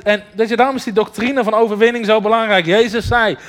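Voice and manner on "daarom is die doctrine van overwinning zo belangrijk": ordinary delivery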